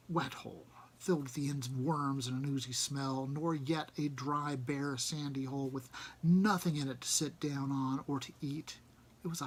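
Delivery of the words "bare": ordinary delivery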